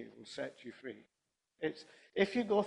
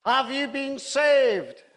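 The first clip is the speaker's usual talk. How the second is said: high, very loud, slow challenge; hammed-up stern demand, drawn out; 11 semitones higher, 11 dB louder, much slower than usual. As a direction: boom it out like a stern street evangelist. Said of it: "in a very stern voice".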